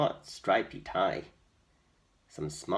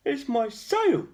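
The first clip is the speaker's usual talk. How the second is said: much higher, louder slow goat voice; sad goat; 10 dB louder than usual. A narrator's reading. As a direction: moan it like a goat who lost its sail. Goat complains to George.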